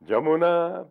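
high, loud commanding call; calling to the river. Acted. Vyasa calling out to the Jamuna, loud high cry. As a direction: summon the river with force. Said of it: "Vyasa cried on reaching the river".

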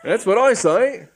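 high, loud insistent cockney; emphatic policeman. Acted. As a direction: hammer the catchphrase home. Policeman repeats catchphrase.

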